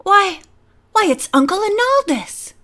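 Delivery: high, astonished recognition; surprised cry. A narrator's reading. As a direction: exclaim as you realise who it is. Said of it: "he cried".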